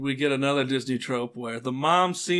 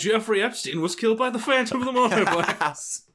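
clickbait video narrator; 7 semitones higher than usual. Pretend. Continues the clickbait video bit.

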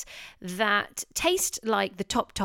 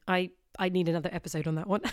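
laughing; 3 dB quieter, much faster than usual. Real, not acted. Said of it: "laughs at own tangent".

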